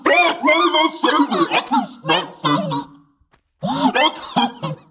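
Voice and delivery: chipmunk voice. Real, not acted. High pitch filter on stream.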